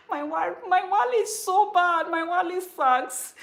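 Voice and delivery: high, loud cry of self-mockery; mock lament. Real, not acted. Teacher bemoaning her bad Wali to viewers, high playful lament. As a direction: wail it playfully, stretching the last word.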